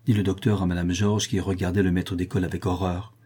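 plain reading voice

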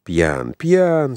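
agreeing. A narrator's reading. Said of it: "согласился Толстой".

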